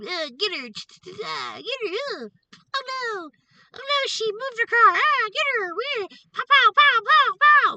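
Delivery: mock him as a bratty toddler mashing his game controller; toddler tantrum. Mocking the stalker as a toddler at his video game, shrill tantrum squeal. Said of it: "a little toddler throwing a tantrum".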